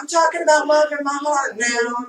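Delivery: loud, high, laughing protest; half-laughing loud protest; laughing shout. Real, not acted. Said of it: "laughing shout at friend".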